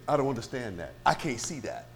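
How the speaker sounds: low, quiet doubter's voice; mock the reluctant listener, soft and subdued; natural man. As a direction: voice a puzzled objector under your breath. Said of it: "That's the natural man talking".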